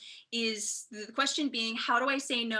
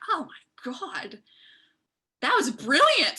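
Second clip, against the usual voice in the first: amazed; 3 semitones higher, 5 dB louder, slower than usual. Retold or imitated. Amazed at his reply.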